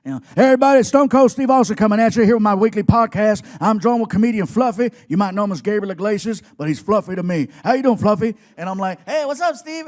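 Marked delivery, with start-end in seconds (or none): 0.4-8.4 loud host announcer voice; podcast intro. Retold or imitated. Wrestler opening his weekly podcast, loud announcer style. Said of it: "he just starts". 9.0-9.9 high-pitched fanboy greeting; geeking out. Retold or imitated. Starstruck fan greeting his hero, high and giddy. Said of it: "I'm geeking out already".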